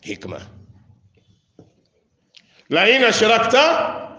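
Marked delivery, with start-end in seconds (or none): 2.7-4.1 high, slow chanted verse; scripture recitation. Real, not acted. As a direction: recite it out with drawn-out syllables. Verse recited, later cited in explanation.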